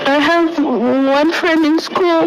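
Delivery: tearful, voice pitched high and breaking; real tears while recounting the ordeal; crying. Real, not acted. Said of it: "I just have to cry".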